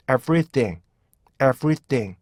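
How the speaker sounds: everything said evenly, each syllable the same length; model everything. Shown as a demonstration. Teacher models the word for students, even and clear. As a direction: clean even demo of everything. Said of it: "이렇게 발음하시면 정확히".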